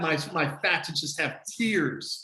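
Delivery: usual speaking voice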